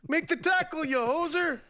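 high, hammed-up Canadian taunt; Canadian accent. Host taunting a player in a high mock Canadian accent. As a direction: rib the player like a Canadian hoser. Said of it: "Come on, hoser".

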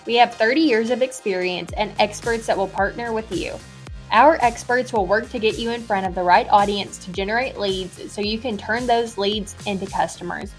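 normal talking voice

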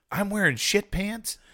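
high, slow appalled voice; learning what the uniform holds, high and appalled; mock horror. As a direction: stretch it out in disbelief. Retelling horrified question.

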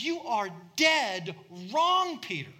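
slow, loud, heavy rebuke on wrong; stern rebuke. Retold or imitated. Jesus rebuking Peter, slow and stern. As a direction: draw out dead wrong as a verdict. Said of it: "And Jesus says".

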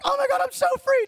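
high, thrilled cry of release; overjoyed. Retold or imitated. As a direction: worshipper feeling free for the first time, overjoyed. Worshipper's joyful release.

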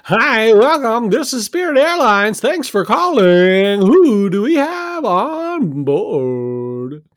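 bright, slow customer service voice; cheery phone agent, stretched out; chipper agent voice. Acted. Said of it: "this isn't what you sound like".

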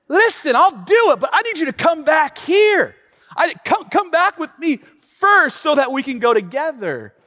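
pleading Barak. Retold or imitated. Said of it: "and he says".

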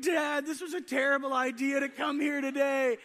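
mock wail, high and loud; high-pitched tearful complaint, imitated; crying child. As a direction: whine it loudly like an upset kid.

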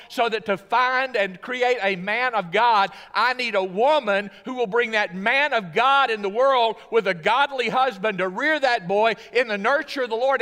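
loud, high, commanding voice of God; grand divine address, proclaimed; God speaking. Acted. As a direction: speak as God calling Hannah. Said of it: "he said".

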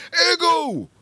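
a very high, loud yell of the name; screaming the name. Retold or imitated. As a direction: bellow it like a cartoon dad calling. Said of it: "he screams Igu's name".

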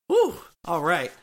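ordinary talk

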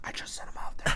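hushed sinister whisper. Pretend. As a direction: whisper it like a creepy plotter in an alley. Imagined sinister alley voice.

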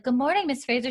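a hint of a chirpy servant; lightly voiced maid greeting. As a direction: imitate a maid's high morning hello.

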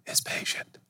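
whispered, almost gone; whispered punchline; whispered aside. Acted. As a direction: drop it to a hush for the punchline.

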